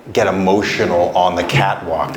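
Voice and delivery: ordinary delivery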